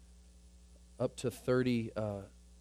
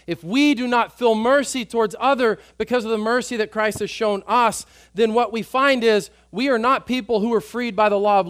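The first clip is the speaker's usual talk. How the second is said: louder, higher preaching at full force; fervent preaching; 7 semitones higher, 10 dB louder than usual. Acted. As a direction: drive it home to the congregation.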